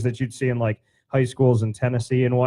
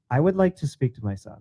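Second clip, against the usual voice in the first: mock formal mom. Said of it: "she goes".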